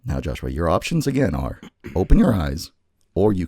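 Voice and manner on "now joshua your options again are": normal talking voice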